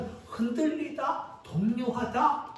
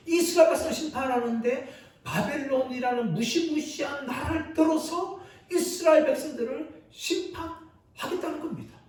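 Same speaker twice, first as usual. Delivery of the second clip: ordinary talk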